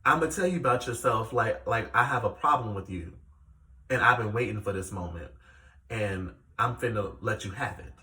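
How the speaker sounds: low, hostile confrontation, held in check; imitating hostile castmate. Castmate confronting Chrisean over being late, low controlled hostility. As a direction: lay it out as a low warning. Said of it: "She was very hostile".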